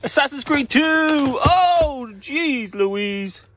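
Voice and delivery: high, loud, giddy delight; excited squeal. Real, not acted. Hyper seller squealing over his first sale.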